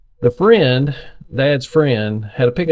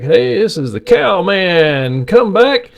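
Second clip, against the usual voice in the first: slow, higher CB radio call, rising to high; dad on the CB; 7 semitones higher, slower than usual. Retold or imitated. Son imitating dad calling on the CB radio, slow and high. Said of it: "So Dad would be like".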